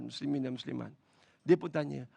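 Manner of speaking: usual speaking voice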